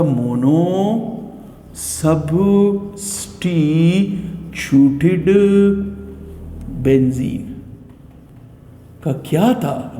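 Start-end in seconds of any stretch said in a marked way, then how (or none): 0.0-7.6 slow drawn-out quiz answer. Shown as a demonstration. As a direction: stretch मोनोसब्स्टिट्यूटेड out syllable by syllable. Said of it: "कौन सा nomenclature था?".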